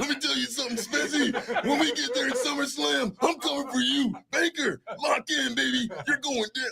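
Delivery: loud, high hype announcer voice; wrestling promo. Pretend. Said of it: "like, a wrestling promo".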